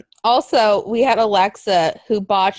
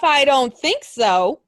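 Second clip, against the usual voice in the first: usual speaking voice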